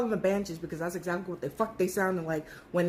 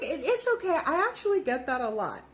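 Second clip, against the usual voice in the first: put-on sweet old lady; 5 semitones higher than usual. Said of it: "this lady was so sweet".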